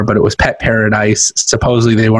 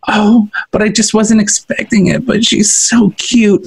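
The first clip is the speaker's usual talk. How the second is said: high, cooing imitation of the wife; mock whiny wife; 9 semitones higher than usual. Husband mimicking his wife cooing about the dog. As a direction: coo it high and sweet.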